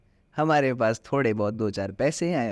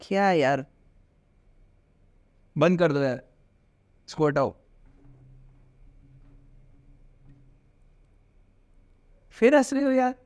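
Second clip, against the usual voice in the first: exasperated anger; 4 semitones higher than usual. Shown as a demonstration. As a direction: snap at him to stop laughing. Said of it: "मुझे गुस्सा आएगा".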